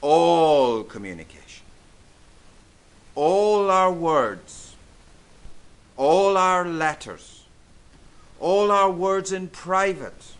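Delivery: loud, drawn-out ALL to open each phrase; emphatic preaching. Real, not acted. Preacher warns the congregation that all words are assessed, emphatic and loud. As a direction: preach it slowly and forcefully, leaning on every "all". Preacher driving a point home.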